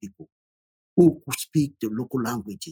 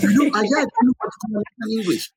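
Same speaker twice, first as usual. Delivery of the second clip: higher, laughing, incredulous retort; laughing disbelief; 10 semitones higher, 3 dB louder, faster than usual. Retold or imitated. Retelling a reply to visiting family members, higher and laughing in disbelief. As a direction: laugh off the idea in a raised voice. Mocking confusion about American English.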